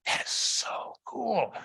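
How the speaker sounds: slow, breathed wonder; hushed, drawn-out awe, imitating a girl; awed child. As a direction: marvel softly like an amazed kid.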